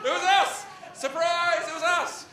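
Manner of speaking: spring the prank reveal with glee; mock-cheerful big reveal; cheery reveal.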